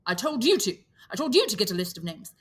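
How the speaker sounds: put-on firm boy's retort, hurried on the second line; Ralph retorting. Ralph rebuking Piggy about the list, firm. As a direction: snap back at him.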